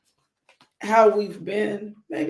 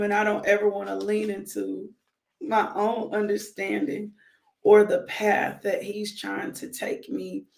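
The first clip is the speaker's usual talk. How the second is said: ordinary delivery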